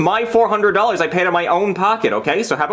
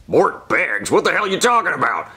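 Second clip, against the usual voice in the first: lightly voiced boss. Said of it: "it's my boss from nasa".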